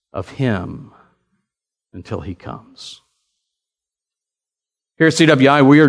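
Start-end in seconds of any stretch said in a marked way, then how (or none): none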